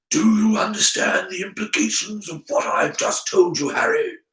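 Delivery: lightly voiced earnest headmaster; earnest Dumbledore. Headmaster checking that Harry has understood him, earnest.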